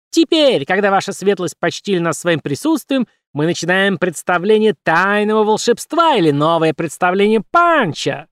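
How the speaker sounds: high showman puppet; squeaky puppet announcer. Acted.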